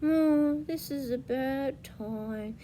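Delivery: slow, soft and downcast; sad voice. Shown as a demonstration. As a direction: let it sag like bad news. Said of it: "That's a sad voice".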